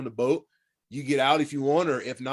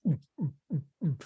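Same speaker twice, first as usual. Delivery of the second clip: mock fish grunts; imitated grouper grunt; 6 dB quieter, faster than usual. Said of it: "was just going".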